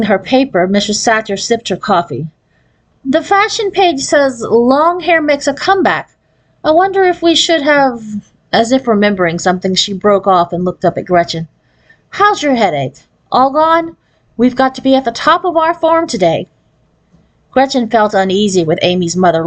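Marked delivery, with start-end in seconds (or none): 3.1-8.0 higher, chatty society-mom voice; idle chatter over coffee; mother reading the paper. A narrator's reading. Mother musing over the newspaper at breakfast, chatty and higher. 12.1-16.5 higher, brisk, bright-eyed mother; cheerful but demanding; pushy stage mother. A narrator's reading. Stage mother checking on her daughter, brisk and higher. Narrator voices Amy's mother.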